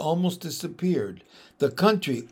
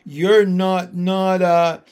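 shabby voice; 3 semitones higher, 7 dB louder, slower than usual. Acted. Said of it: "in a shabby voice".

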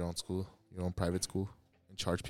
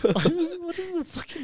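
shocked; 6 dB louder than usual. Real, not acted. Shocked at a picture.

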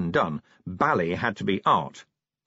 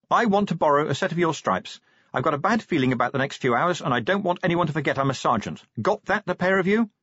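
higher, brisk woman sergeant; businesslike officer giving instructions; commanding Angua; 7 semitones higher than usual. Acted. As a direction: issue firm orders from below, pitched up.

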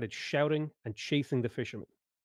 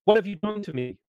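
much higher, louder anguished cry; crying out in pain; 9 semitones higher, 5 dB louder than usual. Acted. Fisherman turning into a fish crying to the wizard, anguished. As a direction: scream it as the body changes. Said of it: "cried out in pain".

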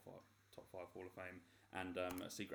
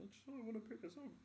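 much higher, whiny plea; mock whiny thought; 5 dB quieter than usual. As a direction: whine it like a fed-up kid.

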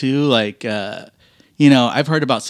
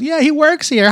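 much higher, teasing coworker voice; high mock coworker. Mechanic mimics a coworker joking about him, high and teasing. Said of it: "He goes".

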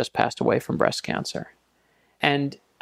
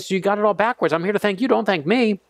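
insistent protest; 7 semitones higher than usual. Retold or imitated. Insisting he thanks the soldier.

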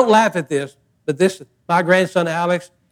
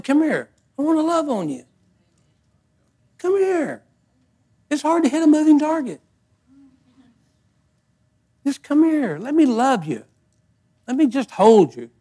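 tender voice of God; 8 semitones higher than usual. Retold or imitated. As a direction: coax gently like a loving father. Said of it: "God said".

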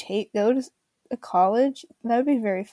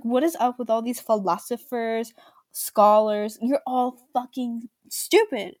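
ordinary delivery

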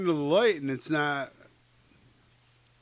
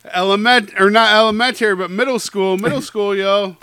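excited; 5 semitones higher, 11 dB louder than usual. Real, not acted. Excited nostalgic outburst.

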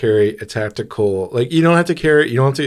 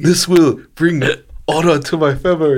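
put-on Japanese accent; 6 semitones higher, 3 dB louder than usual. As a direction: solemnly ham up a Japanese accent. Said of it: "I've ever done a racist accent before".

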